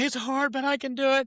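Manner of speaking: high-pitched tearful kid, retold; crying child. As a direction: imitate a boy repeating through tears. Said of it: "he's crying in tears".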